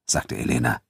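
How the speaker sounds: plain reading voice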